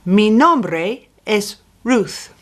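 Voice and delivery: Mi nombre es Ruth said slowly and clearly; slow demo. Shown as a demonstration. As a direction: spell out each word of Mi nombre es Ruth. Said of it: "How do I say".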